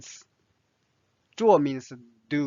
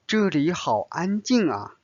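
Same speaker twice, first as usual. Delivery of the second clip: ordinary talk, nothing marked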